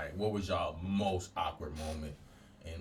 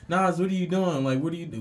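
high, loud teasing call; teacher calling out; 11 semitones higher, 5 dB louder, faster than usual. Retold or imitated. Classmates calling out to him at the board, high and loud. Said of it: "They'd be like".

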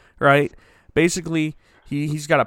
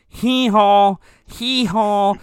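hammed-up donkey hee-haw, a little louder; donkey bray, mock; 4 semitones higher, 6 dB louder, much slower than usual. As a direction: bray it like a hurt donkey dad. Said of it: "When the dad is like".

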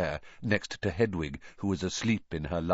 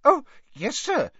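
very high, eager elf voice; earnest, piping little servant; 5 dB louder than usual. A narrator's reading. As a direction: squeak it with earnest emphasis. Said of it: "said Dobby earnestly".

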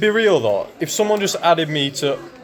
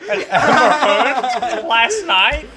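high, slow alien gossip voice; giggly squeaky Gungan voice; Jar Jar voice; 8 semitones higher, slower than usual. Pretend. Jar Jar voice continued.

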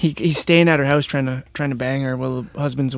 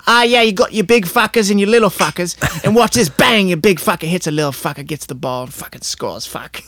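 higher Aussie commentator, fading out; Aussie commentary; 3 semitones higher than usual. Retold or imitated. Husband commentating Aussie Rules for George, higher then fading. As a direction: call the footy like a crude Aussie mate. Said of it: "He's like".

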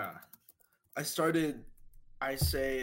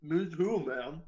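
talking with a mouthful; words round a bite of chicken; eating crunchy fried chicken; 3 semitones higher, slower than usual. Real, not acted. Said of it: "sorry, I was eating".

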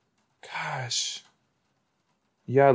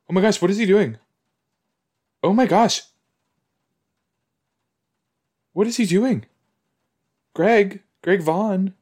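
high-pitched, alarmed questioning; shocked disbelief; 9 semitones higher, 4 dB louder than usual. Real, not acted. Viewer reacting to what he is doing, shocked. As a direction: exclaim in high, startled disbelief.

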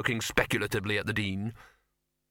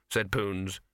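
ordinary delivery

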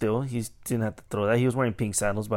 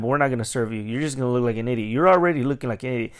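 usual speaking voice

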